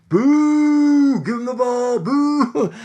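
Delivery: high, drawn-out crowd boos; long, high mock heckle; imitated booing crowd. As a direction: mimic a ballpark crowd turning on someone. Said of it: "the crowd turned on that dude instantaneously".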